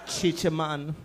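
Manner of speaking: put-on Jamaican accent. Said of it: "Jamaican nurse, and she's like".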